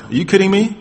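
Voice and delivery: high-pitched disbelieving question; incredulous David. Acted. Young David dismisses warnings about the giant, high incredulous voice. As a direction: toss it up in disbelief. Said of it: "David says".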